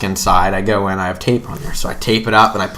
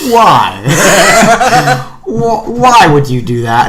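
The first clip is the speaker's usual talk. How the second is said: higher, louder little Who voice, pleading through a laugh; imitating a wide-eyed child asking why; Cindy Lou Who; 10 semitones higher, 6 dB louder, slower than usual. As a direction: plead like an innocent child caught by the Grinch. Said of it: "eyes like Cindy Lou Who".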